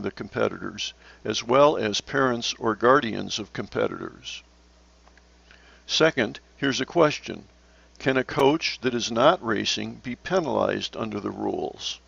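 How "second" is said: usual speaking voice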